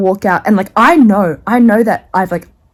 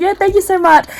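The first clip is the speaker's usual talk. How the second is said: higher, sheepish mock sweetness; awkward thanks; 10 semitones higher than usual. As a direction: chirp it guiltily. Her awkward reply to the hairdresser.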